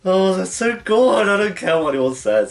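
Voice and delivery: high, loud, drawn-out gush; gushing fan. Real, not acted. Gushing over the couple's scene.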